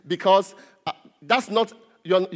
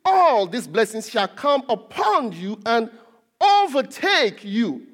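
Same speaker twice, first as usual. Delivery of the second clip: emphatic scripture reading; slower than usual. Real, not acted. Preacher reads the verse to congregation, slow and emphatic. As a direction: proclaim the verse slowly, swelling on key words.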